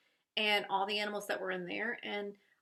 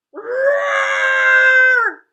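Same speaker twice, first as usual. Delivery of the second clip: much higher, much louder playful roar; Yeti roar; 13 semitones higher, 18 dB louder than usual. Acted. The Yeti roaring at the bird, big and hammy. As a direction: let out a big hammed-up monster roar. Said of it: "says the Yeti".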